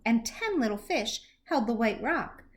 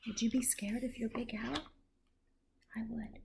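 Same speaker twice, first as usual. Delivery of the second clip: soft whispered aside; whispered question and answer; 12 dB quieter, faster than usual. Real, not acted. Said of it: "aside to listening child".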